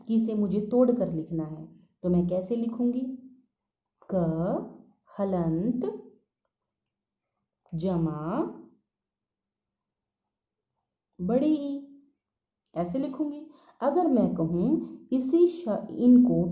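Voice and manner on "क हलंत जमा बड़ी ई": drawn-out words with long pauses; slow dictation. Real, not acted. Teacher writing out a broken-up syllable for pupils, slow dictation. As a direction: spell it piece by piece, very slowly, as if writing. Spelling aloud while writing it out.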